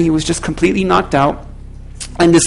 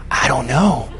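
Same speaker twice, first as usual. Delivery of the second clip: teasing; 6 semitones lower, 5 dB quieter than usual. Retold or imitated. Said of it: "I was having fun with her".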